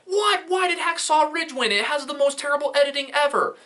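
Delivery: very high-pitched whiny mock outrage; mock outraged fan. Imitating people angry about the editing win, high mock outrage. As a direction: squeal it like an indignant complainer, hammed up.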